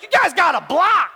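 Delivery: dad shouting at the players; yelling at the TV. Retold or imitated. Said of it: "he's like".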